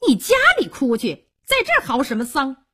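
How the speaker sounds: high, irritated woman's rebuke; sharp, high-pitched annoyance; displeased scolding. Acted. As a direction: scold a wailing man out of the house. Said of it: "萧娥不如意了".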